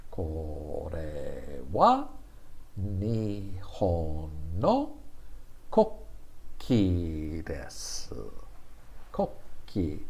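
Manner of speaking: very slow, one beat per syllable. Shown as a demonstration. Teacher drilling the sentence syllable by syllable, very slow. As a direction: spell out each syllable slowly for learners. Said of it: "this is one second per, uh, letter".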